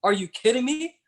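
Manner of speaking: incredulous. Retold or imitated. Club president hears she's leaving, high incredulous. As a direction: scoff in high disbelief. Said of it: "that statement was met with".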